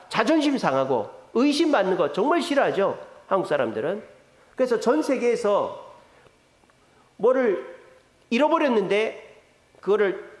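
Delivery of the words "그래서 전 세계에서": ordinary talk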